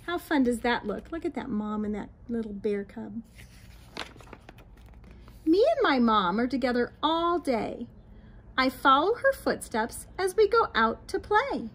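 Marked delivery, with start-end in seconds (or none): 5.5-11.7 lilting, sing-song storybook read; reading to children. A narrator's reading. Teacher reading a picture book to children, lilting. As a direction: read it aloud to little ones, bright and rhythmic.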